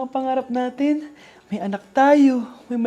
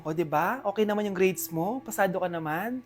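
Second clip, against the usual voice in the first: soft and gentle; 6 semitones lower, 8 dB quieter than usual. Acted. Mother gently checks on child.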